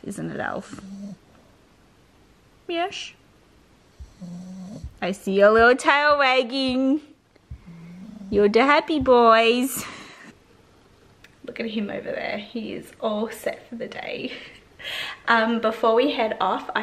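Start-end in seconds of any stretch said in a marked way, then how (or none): 2.7-9.8 cheery pet talk. Real, not acted. To her dog Alfie wagging his tail, cheery pet talk. 11.4-14.3 soft whisper aside. Real, not acted. To viewers about Alfie settled on the couch, whisper.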